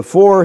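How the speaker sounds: "for" popped high and loud; stress on for. Shown as a demonstration. Preacher singling out the preposition, stress on "for". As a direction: punch the word "for". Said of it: "here is the preposition huper".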